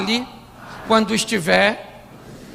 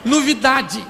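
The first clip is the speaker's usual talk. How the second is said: higher-pitched comic greeting; conversa de casal encenada; 6 semitones higher than usual. Retold or imitated. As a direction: play it up, high and chatty. Diálogo cômico de Maria e José. (diálogo cômico em voz mais aguda)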